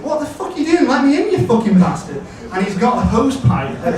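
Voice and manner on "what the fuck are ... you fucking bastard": high-pitched mock shout up at a window; retold shouting. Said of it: "Tom is shouting up at me".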